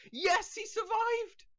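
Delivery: high, slow triumphant yelp; excited cheer. Real, not acted. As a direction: celebrate like you can't believe it. Patient survives surgery.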